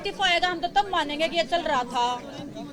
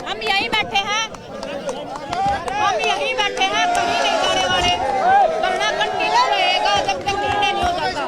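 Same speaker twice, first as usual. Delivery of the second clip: loud, raised voice to the crowd; defiant declaration; 3 semitones higher, 7 dB louder than usual. Real, not acted. (भीड़ के सामने ऊँची, बुलंद आवाज़ में ऐलान)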